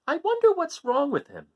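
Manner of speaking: high worried mother voice; Mother Goat worried. Acted. Mother worrying about her son, high and concerned. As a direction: wonder it aloud, high and concerned. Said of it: "said Mother Goat".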